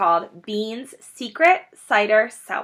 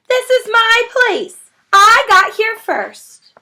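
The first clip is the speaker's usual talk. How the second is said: high, loud, slow shriek; shrieking rat; 13 semitones higher, 10 dB louder, slower than usual. Acted. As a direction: insist loudly it's his. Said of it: "shrieked Rat".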